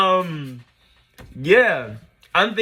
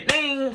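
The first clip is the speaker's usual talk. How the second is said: high drawn-out "ding"; imitated phone ding; 11 semitones higher than usual. Phone alert as his reply comes in, high imitated ding. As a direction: sing out a message chime, high.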